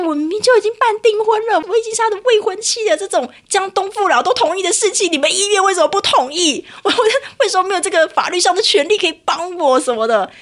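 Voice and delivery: protesting the unfairness; indignant rant. Retold or imitated. Indignant about hospital's refusal. (委屈又愤慨地质问)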